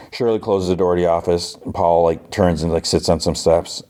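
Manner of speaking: ordinary talk, nothing marked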